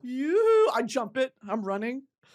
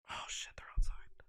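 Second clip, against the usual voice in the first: hushed warning into a phone; whisper; 12 dB quieter than usual. Pretend. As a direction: whisper as if they are at the door. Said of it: "pretend phone call".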